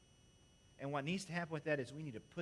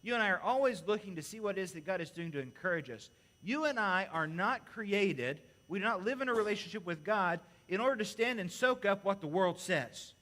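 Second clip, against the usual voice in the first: louder, urgent preaching; preaching with force; 3 semitones higher, 6 dB louder than usual. Acted. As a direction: drive the point home to the congregation.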